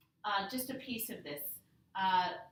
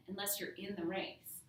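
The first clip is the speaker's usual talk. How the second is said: ordinary talk, nothing marked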